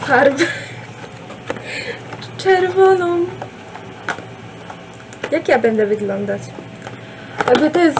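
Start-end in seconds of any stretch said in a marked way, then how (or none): 0.5-2.3 breathless laugh. Real, not acted. Laughs about buying red dye. 2.4-3.2 excited reveal. Real, not acted. Reveals red hair dye.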